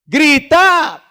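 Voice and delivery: very loud, high yell; full shout. Shown as a demonstration. Preacher showing how to shout properly, full-voiced yell. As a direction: belt it out as a real shout. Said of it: "grite bien, hombre".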